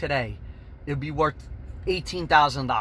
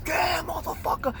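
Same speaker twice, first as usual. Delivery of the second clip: high, excited holler; escape whoop. Retold or imitated. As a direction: cry out in amazement on the getaway. Whooping while driving away.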